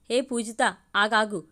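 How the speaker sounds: ordinary delivery, nothing marked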